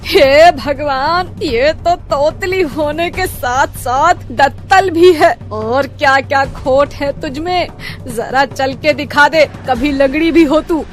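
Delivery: high, slow, outraged shriek; shrieking mother-in-law. Acted. Mother-in-law shrieks at the lisping bride's teeth. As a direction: wail at the heavens in horror. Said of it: "Chitra एकदम से चिल्लाने लगती है".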